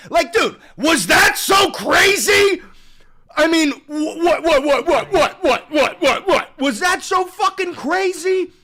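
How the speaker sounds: high, loud laughing exasperation; exasperated shout. Real, not acted. Streamer yelling at chat over a reasonable question. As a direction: yell at chat in disbelief.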